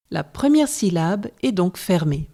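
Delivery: usual speaking voice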